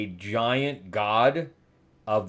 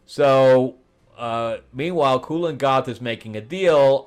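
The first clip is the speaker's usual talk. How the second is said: usual speaking voice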